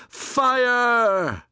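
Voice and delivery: high, long, loud cry; shouted alarm. A narrator's reading. Townsmen spreading a fire alarm, shouting. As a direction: cry it out down the street. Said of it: "he could hear shouting".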